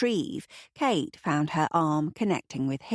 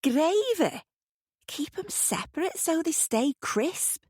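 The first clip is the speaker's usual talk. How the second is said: higher, appalled protest; horrified; 12 semitones higher than usual. A narrator's reading. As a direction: recoil at the idea, voice up high. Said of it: "looking horrified".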